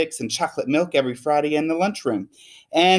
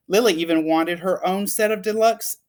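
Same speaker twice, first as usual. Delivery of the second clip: ordinary delivery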